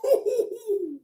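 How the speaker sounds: high burst of real laughter. Real, not acted. Laughing at the story, cracking up high.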